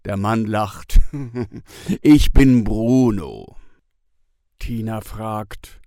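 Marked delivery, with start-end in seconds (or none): 2.0-3.6 man's voice. A narrator's reading. Bruno introduces himself.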